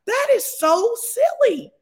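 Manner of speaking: squealing high and slow; high slow exclamation. Acted. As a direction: shriek it out in disbelief. Preacher exclaims.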